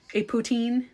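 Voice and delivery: poutine said the French way, poo-TSIN. Shown as a demonstration. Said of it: "you have to say it with the French accent".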